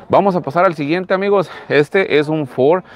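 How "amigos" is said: ordinary talk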